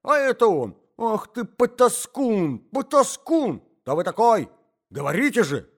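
put-on old man stamping and railing at the intruder; angry old husband. A narrator's reading.

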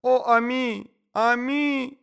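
high, loud melodramatic wail; lamenting cries. A narrator's reading. Lady mourning her lapdog Ами, lamenting cries. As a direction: wail for a dead pet. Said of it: "кричала дама".

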